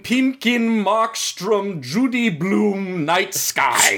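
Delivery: high, slow herald voice; stiff ceremonial announcing. Pretend. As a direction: announce each name like a court herald. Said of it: "announcing the full names as everyone comes in".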